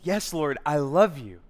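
emphatic affirmation; Peter answering earnestly. Acted. Peter assuring Jesus of his love, earnest. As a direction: answer firmly, leaning on the love. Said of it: "And Peter goes".

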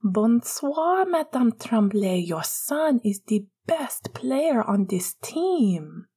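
high, drawn-out mock politeness; put-on high voice. A narrator's reading. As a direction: gush slowly in a high voice. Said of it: "put on a high voice".

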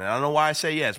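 normal talking voice

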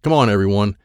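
ordinary delivery, nothing marked

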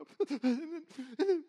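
high, hitching mock sobs; imitated choked crying stammer; sobbing, wordless. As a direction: blubber without getting words out. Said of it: "I can't get the words out to her".

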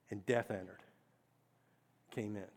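soft and low; hushed weight on each line; solemn. Acted. Preacher ends the story of the fall, hushed and grave.

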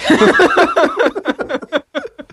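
real high rising laughter. Real, not acted. After explaining her own joke, a real high laugh. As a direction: laugh at how silly it was.

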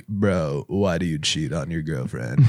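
low, soft, raspy sick voice; deep sick-voice TikTok lecture; TikTok guy voice. Retold or imitated. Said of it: "those guys that you see on TikTok".

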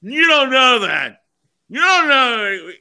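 put-on slow, loud drunken yelling; angry drunk. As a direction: bellow it like a slurring drunk.

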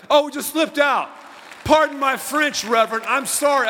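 hammed-up sorry to the pastor; mock apology. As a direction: play the embarrassed swearer apologizing. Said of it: "People say".

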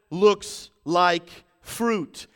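very slow, loud, word by word; weighty, spaced-out preaching; slow emphasis. Real, not acted. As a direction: let each word land separately. Repeated slowly for weight.

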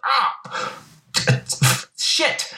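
high yelp then hissed curse; pained yelp. Retold or imitated. Vegeta hit in the eye, mock pained yelp. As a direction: yelp like you took a blast to the eye.

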